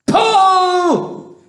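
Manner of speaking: high, drawn-out yelled command; full-voiced urgent shout. A narrator's reading. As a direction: yell the signal to heave. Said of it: "shouted James".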